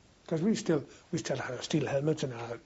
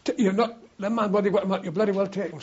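imitated quartermaster, higher and louder, stumbling; exasperated order; 4 semitones higher, 5 dB louder than usual. Quartermaster overrules a soldier refusing kit, exasperated and raised. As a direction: cut off the objection with a raised voice.

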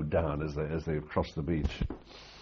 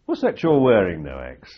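mock sergeant major; 2 semitones higher, 10 dB louder than usual. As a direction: bark the question, then let it fall. Sergeant major questions the marine.

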